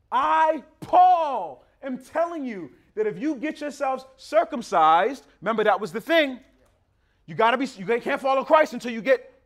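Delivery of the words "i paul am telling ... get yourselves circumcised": loud, high solemn declaration; emphatic. Retold or imitated. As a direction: proclaim it with apostolic weight. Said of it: "he says it with it emphatically".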